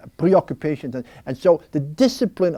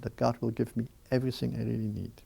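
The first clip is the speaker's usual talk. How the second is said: usual speaking voice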